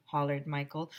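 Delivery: plain reading voice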